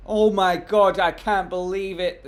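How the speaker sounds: loud, high hammed-up shock; mock disbelief. Streamer reacts to the CEO's tweet: loud mock shock. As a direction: overplay the disbelief.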